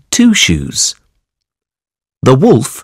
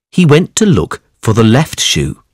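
usual speaking voice

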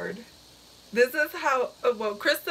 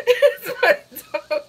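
laughing; 6 semitones higher, 8 dB louder than usual. Real, not acted.

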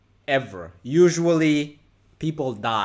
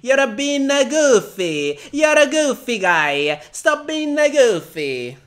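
high, loud, slow mock Italian-ish accent, teasing; 10 semitones higher, 9 dB louder, slower than usual. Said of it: "what kind of accent is that".

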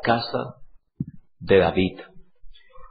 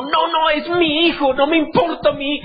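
much higher, pleading father; doting father; 12 semitones higher than usual. Retold or imitated. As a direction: protest like a blind-loving dad. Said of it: "Y David dijo".

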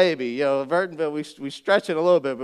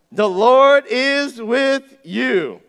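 slow, loud angelic greeting; slow proclamation; 5 semitones higher, 8 dB louder, much slower than usual. Acted.

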